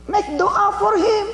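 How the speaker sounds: high, slow, loud call; slow ringing exhortation; preaching emphasis. Acted. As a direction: stretch it out to the congregation. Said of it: "preaching emphasis".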